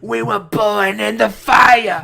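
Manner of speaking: high slow shouting corner trainer; Teddy Atlas shout. Retold or imitated. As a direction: bellow to fire up the fighter. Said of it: "my best impression of a boxing trainer is probably Teddy".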